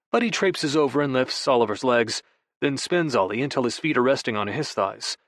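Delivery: normal talking voice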